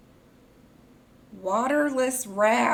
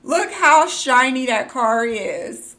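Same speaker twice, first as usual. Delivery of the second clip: louder, still gushing; real excited gush; 2 semitones higher, 8 dB louder than usual. Real, not acted. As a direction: call it out in awe. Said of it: "if I'm screaming in your ear".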